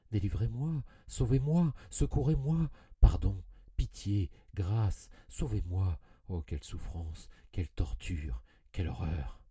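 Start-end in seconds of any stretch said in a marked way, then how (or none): 0.1-6.0 louder and higher, frantic begging for mercy; desperate pleading. A narrator's reading. Haunted narrator begging God to save him, frantic loud pleading. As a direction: beg for your life, building to a cry.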